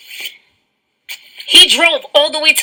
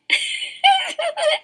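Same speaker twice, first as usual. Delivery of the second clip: high shrieking laugh; 13 semitones higher, 7 dB quieter than usual. Real, not acted. Loud burst of laughter.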